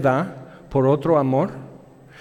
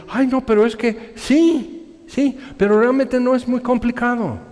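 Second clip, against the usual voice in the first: mock whiny excuse; 8 semitones higher than usual. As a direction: drag it out like a lame excuse.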